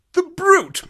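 high outraged exclamation; indignant cry. A narrator's reading. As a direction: cry out in disgust at him. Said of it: "cried Holmes".

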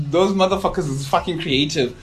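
normal talking voice

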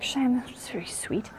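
slow, whispery aside; hushed sympathy. Retold or imitated. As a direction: whisper it slowly, humouring a tearful child. Mother's hushed reply to emotional daughter.

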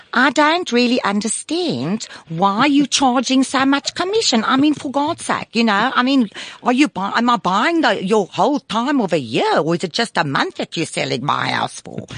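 high-pitched South African caricature, complaining; hammed-up South African client querying the fee; South African accent. Guest mimics a client grumbling about commission, South African accent. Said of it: "you'll have the one who'll say".

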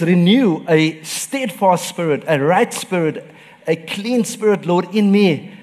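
high, loud, slow pleading prayer; fervent prayer. Acted. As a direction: cry it out to God. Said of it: "And David says".

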